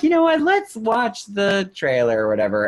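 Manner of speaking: light high voice, playing it cool; lazy, slowed-down fake shrug; mock casual crowd. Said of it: "And we're like".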